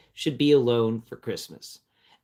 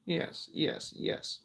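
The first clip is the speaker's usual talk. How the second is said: soft whispered yeses to a pet; whisper to cat; 2 semitones higher, 13 dB quieter, much slower than usual. Real, not acted. Owner whispering softly while petting the cat.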